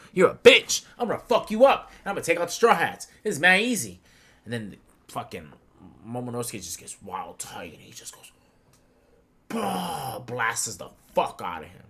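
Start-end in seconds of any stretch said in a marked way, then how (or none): 0.1-4.0 loud, high trash talk; hammed-up villain threat, loud and high; mock taunting. Acts out the Admiral's taunts. 9.5-10.2 high drawn-out "bah" blast noise; imitated blast.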